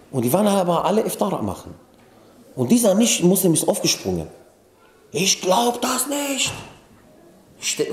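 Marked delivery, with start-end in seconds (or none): 5.1-6.5 nachgeahmter ungläubiger Ausruf, hoch und gedehnt; ungläubiges Staunen. Retold or imitated. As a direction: play a stunned guest in drawn-out, high-pitched disbelief. Aufgesprungener Gast, zitierter Ausruf. (ungläubig staunend, hoch und langgezogen)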